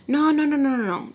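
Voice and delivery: high, loud string of denials; mock protest. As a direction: imitate a hasty high-pitched backpedal. Retold hasty denial when accused.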